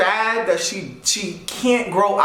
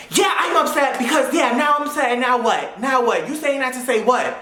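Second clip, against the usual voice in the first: higher, defensive mock rant; mock angry friend; 5 semitones higher than usual. Mimicking the upset friend arguing back on the phone. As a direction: imitate her snapping back. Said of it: "her yelling most of the time".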